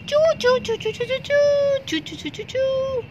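very high chirping; bird chirps. Acted. Little bird calls Barbie for help, very high chirps.

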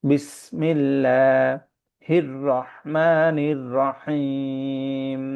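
very slow, drawn-out tajweed chant; Quran recitation. Acted. Teacher reciting the verse for the class, slow chant. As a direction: recite it slowly like a qari.

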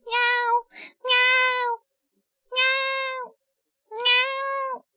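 very high, long kitten mews; cat meowing. Pretend. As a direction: mew like a little cat. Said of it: "I am now a cat".

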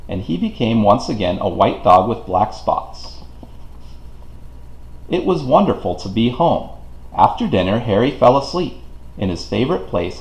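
ordinary delivery

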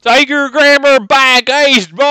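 high twangy cowboy drawl. Acted. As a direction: ham up a flamboyant cowboy.

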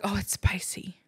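breathy hushed whisper. Retold or imitated. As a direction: whisper it like a complaint. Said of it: "they're like".

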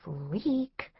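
soft, nasty sneer of one word; mean hiss. Retold or imitated. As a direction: spit it out quietly like a mean girl. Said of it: "hissed in this really mean voice".

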